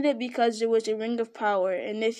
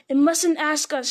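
higher, louder shriek; 5 semitones higher, 7 dB louder than usual. A narrator's reading. Gollum shrieks at Bilbo, higher and louder. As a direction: shriek it back. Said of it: "shrieked Gollum".

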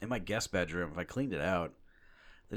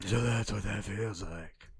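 slow, breathless Batman imitation; mock-heroic, out of air; slower than usual. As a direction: drag it out like a winded Batman. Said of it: "out of breath when he's doing the Batman voice".

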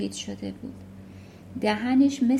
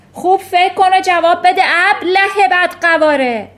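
much higher and louder bellowing headmistress; monstrous Trunchbull roar; 8 semitones higher, 12 dB louder than usual. Acted. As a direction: roar the insults at him. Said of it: "ترانچبول نعره زد".

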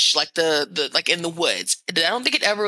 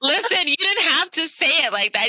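mock offended; 8 semitones higher, faster than usual. Jokingly offended at co-host's reaction.

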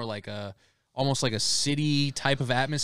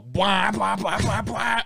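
motorbike revving; 8 semitones higher, much slower than usual. Retold or imitated. Imitating hill climb motorbikes.

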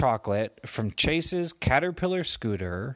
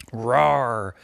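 a loud playful roar to open; lion roar; 5 dB louder than usual. Acted. Chase greeting the crying baby lion, loud playful roar. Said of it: "that's how you talk to lions".

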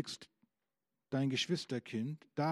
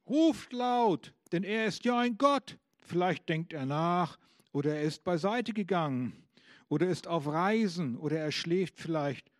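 mocking taunt; 3 semitones higher, 3 dB louder than usual. Elijah taunts the Baal worshippers, loud mocking. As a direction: jeer at them to shout louder for their god. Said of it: "spottete Elia".

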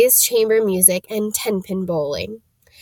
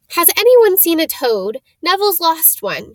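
bossy; 7 semitones higher, 4 dB louder than usual. Acted. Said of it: "She had a bossy sort of voice".

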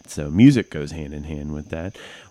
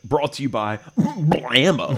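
ad announcer voice; 7 semitones higher, 4 dB louder, slower than usual. Retold or imitated. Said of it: "It was like".

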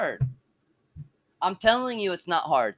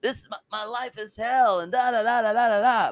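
mock complaining; 5 semitones higher than usual. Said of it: "I'm complaining every day".

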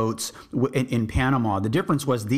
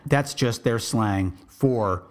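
ordinary delivery

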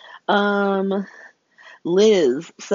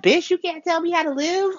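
mock defiant outburst; 11 semitones higher than usual. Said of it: "she's like".

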